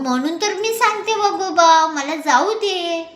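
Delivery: high begging granny voice; pleading old woman. A narrator's reading. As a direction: plead to be let go. Old woman begs again.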